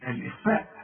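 normal talking voice

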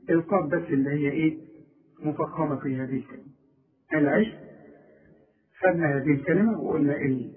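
ordinary talk, nothing marked

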